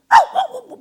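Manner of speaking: very high loud wailing howl. Retold or imitated. Father's tongues imitated from behind, high loud howl. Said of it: "막 이런 소리가 들려요".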